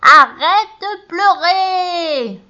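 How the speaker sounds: very high, loud, slow witch scolding; loud drawn-out witch rebuke; snap. A narrator's reading. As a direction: stretch each word out to silence her. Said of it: "dit la vieille".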